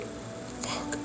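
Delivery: whispered curse under breath; frustrated whisper. Real, not acted. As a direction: hiss it to yourself, annoyed. Frustrated, can't recall name.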